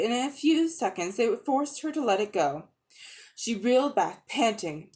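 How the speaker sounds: plain reading voice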